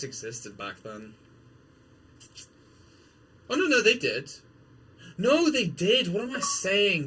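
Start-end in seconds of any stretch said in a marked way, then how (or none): 3.5-7.1 higher, louder oh no they did; catching his own mistake out loud; startled self-correction; sudden realization. Real, not acted.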